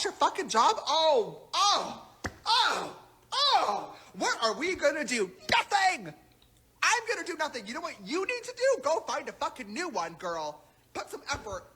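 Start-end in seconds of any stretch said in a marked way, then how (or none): 0.9-5.3 higher, louder mocking oh's; sing-song fake alarm; mock panic. 5.5-10.6 higher, mocking sneer voice; hammed-up smug tone; mocking impression. Mocking James's reply.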